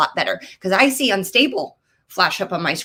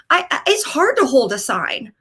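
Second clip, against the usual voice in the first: normal talking voice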